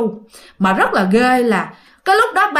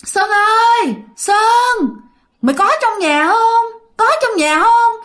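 high, far-off ghostly call; haunting call for Sơn, pitched high; eerie calling voice; 11 semitones higher, 3 dB louder, slower than usual. Retold or imitated. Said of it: "nghe cứ kêu hoài".

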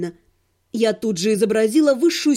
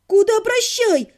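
high indignant outburst; 8 semitones higher, slower than usual. A narrator's reading. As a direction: protest sharply. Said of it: "возмутилась я".

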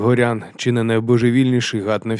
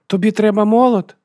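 put-on very high, loud child's question; high-pitched, pushy child's voice; eager little girl; 12 semitones higher, 8 dB louder than usual. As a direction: butt in as a competing sister. Daughter outbids brother with hammer.

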